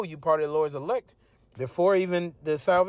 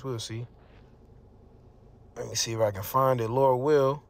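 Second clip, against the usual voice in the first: ordinary talk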